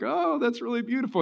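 high, soft forced compliment; fake polite praise. College friends praising a tiny diamond, forced high politeness. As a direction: coo it while hiding dismay.

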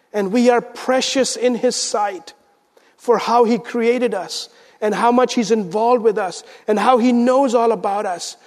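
normal talking voice

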